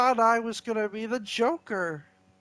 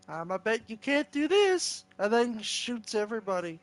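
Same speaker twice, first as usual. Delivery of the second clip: ordinary talk, nothing marked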